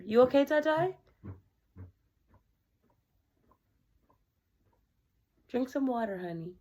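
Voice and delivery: high sweet voice to a pet; tender pet talk. Real, not acted. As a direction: coax the sick dog gently. Talking tenderly to coughing dog.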